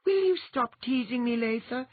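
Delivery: high, mildly flustered protest; a touch of teased exasperation; exclaiming. A narrator's reading. Tiny girl protesting her friend's teasing, mild exclamation. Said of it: "Andine exclaimed".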